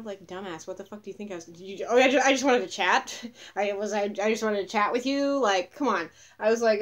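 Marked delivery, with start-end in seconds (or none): 1.9-5.4 louder, higher mock pleading; mocking imitation. Woman mimicking the reporter who just wanted to chat, mocking. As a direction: mimic him with exaggerated innocence. Mimics camera man's excuse, scornful.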